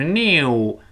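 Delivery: British "new" with a strong Y glide, "nyoo"; British-style drawn-out "new". Shown as a demonstration. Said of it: "英国人强烈的有个耶的". (英式读法，"new"带明显的耶音)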